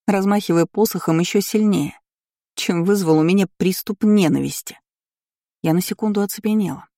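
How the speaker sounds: ordinary delivery, nothing marked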